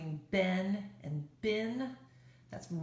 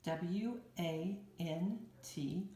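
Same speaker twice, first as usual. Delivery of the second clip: letters "W A N T" said one by one, slowly; slow letter-by-letter spelling; 6 dB quieter, much slower than usual. Shown as a demonstration. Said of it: "One more time".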